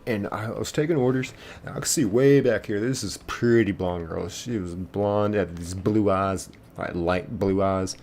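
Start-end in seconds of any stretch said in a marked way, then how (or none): none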